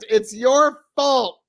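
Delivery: imitated yell, held back in volume; retold heckler's yell. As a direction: draw out the mock accusation.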